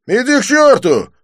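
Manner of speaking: high, loud snap; snapping. A narrator's reading. The thug snaps at the narrator, angry. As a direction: snap back at him. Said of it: "огрызнулся здоровяк".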